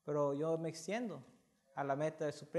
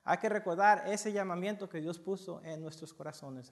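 ordinary talk, nothing marked

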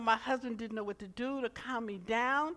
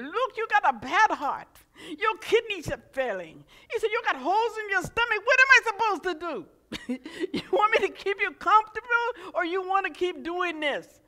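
louder, higher, blunt doctor impression without much accent; 11 semitones higher, 12 dB louder than usual. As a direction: play the curt doctor with the big accent, brusque. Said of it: "Armenian type doctor, a little short with a big accent".

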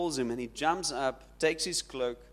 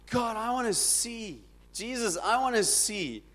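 lightly acted beggar's appeal; acted plea; 6 semitones higher than usual. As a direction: plead with raised pitch, drawing out the wish. Blind beggar's acted plea to Jesus.

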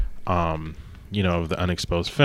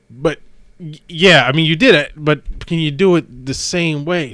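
exasperated director; 8 semitones higher, 7 dB louder than usual. Retold or imitated. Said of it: "he's like".

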